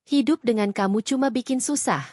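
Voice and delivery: ordinary delivery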